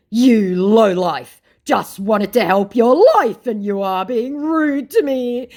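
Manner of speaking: loud, slow, high indignation; mock scammer outrage. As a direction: play the wounded scammer, hammed-up. Acts out the offended scammer.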